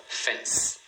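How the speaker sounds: "fence" in a single beat; "fence" with no vowel after the S; one syllable. Shown as a demonstration. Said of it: "também uma sílaba".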